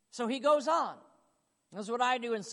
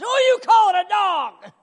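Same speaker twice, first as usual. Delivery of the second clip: loud, high, offended outburst; indignant shout; 7 semitones higher, 14 dB louder than usual. Pretend. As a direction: hurl it back in mock outrage. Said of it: "if she comes back and says".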